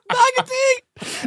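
high, loud laughing cry. Real, not acted. Host cracking up at the bag of tea mistake, laughing. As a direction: shriek it through tears of laughter. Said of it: "laughing at the favourite malapropism".

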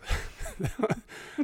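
breathy laugh. Real, not acted. Burst of laughter.

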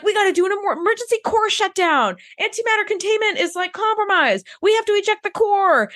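urgent, high crew officer voice; panicked crew officer. Retold or imitated. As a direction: rush the orders like a panicking officer. Crew panicking in retold scene.

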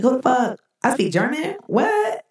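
mock bewilderment, voice jumping up; startled discovery, rising to a high "what"; Jason Bourne. Man waking to find he speaks German, acted astonishment. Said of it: "like Jason Bourne when he woke up".

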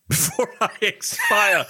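laughing while echoing the phrase, high up. Real, not acted. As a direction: laugh out the words. Laughing echo of partner's words.

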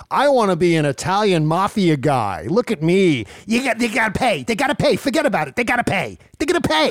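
hammed-up Italian mafia guy accent; mock mafia accent. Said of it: "he was really leaning on that accent".

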